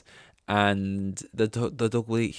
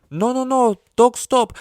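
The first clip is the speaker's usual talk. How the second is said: much higher and louder, shrieked; a woman yelling to stop a dog; frantic shout; 14 semitones higher, 10 dB louder than usual. Retold or imitated. A woman at the park shrieking at a dog, much higher and louder. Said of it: "she said, like".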